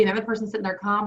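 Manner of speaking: normal talking voice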